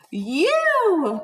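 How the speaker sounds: loud pounce cry. Acted. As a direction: shout it to scare. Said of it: "cried the wolf".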